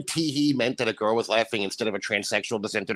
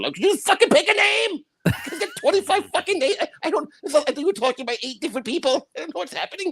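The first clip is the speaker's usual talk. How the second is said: very high-pitched comic outburst; exasperated rant. Retold or imitated. Co-host ranting about Dune's many names, high squealed exasperation. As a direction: squeal in mock frustration at total confusion.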